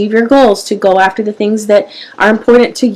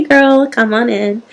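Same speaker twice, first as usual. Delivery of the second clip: normal talking voice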